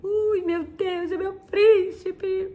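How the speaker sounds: high, slow lovestruck sigh over her prince; swooning girl. Retold or imitated. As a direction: melt like a smitten teen girl. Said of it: "a gente fazia assim".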